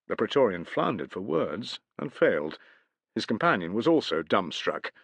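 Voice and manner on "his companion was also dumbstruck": ordinary delivery, nothing marked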